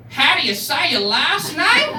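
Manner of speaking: high, loud, slow questioning voice; mock cop lady. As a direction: imitate a cop lady puzzling over a name. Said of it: "the cop lady".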